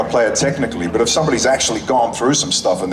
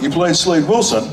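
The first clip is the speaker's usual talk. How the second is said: incredulous officer; 8 semitones higher than usual. Retold or imitated. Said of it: "he went".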